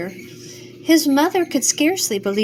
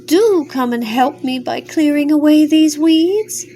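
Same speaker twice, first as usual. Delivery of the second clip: pleading; 5 semitones higher, 5 dB louder than usual. Acted. Stream pleading for help.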